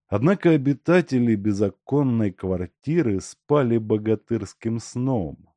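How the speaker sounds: ordinary delivery, nothing marked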